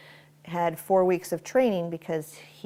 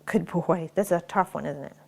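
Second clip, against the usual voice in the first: ordinary talk, nothing marked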